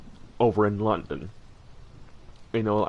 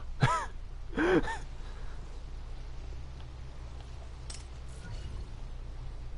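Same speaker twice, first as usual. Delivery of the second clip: long amused laugh; 9 dB quieter than usual. Real, not acted.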